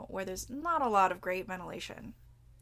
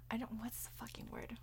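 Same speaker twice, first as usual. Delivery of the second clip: voiceless whisper to herself; whispered aside; 2 semitones higher, 12 dB quieter than usual. Real, not acted. Host mumbling to herself over a lost word. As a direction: whisper it while searching for the word. Muttered while searching for word.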